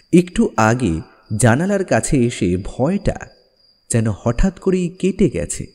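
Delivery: plain reading voice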